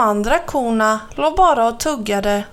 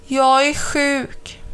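slow, sorry-for-herself voice; slowly; 3 semitones higher, slower than usual. A narrator's reading. Mamma Mu telling crow she's sick, slow and pitiful. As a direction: drag it out, feeling poorly. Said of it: "sa Mamma Mu sakta".

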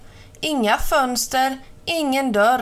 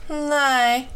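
higher, drawn-out 'nej'; slow reply; 4 semitones higher than usual. A narrator's reading. Mamma Mu admits she is not glad, slow reply. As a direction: let the answer come slowly. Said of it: "sa Mamma Mu sakta".

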